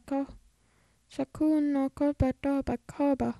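whispered mumble; whispering. Real, not acted. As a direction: whisper the name. Mumbling a hard name.

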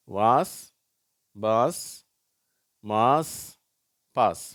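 வாஸ், பாஸ், மாஸ், பாஸ் each with open "aa"; list-reading of வாஸ், பாஸ், மாஸ், பாஸ் with long "aa"; long "aa" pronunciation. Shown as a demonstration. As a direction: recite வாஸ், பாஸ், மாஸ், பாஸ் as separate model words. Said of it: "அதுவும் அதே ஆ என்ற எழுத்து தான்".

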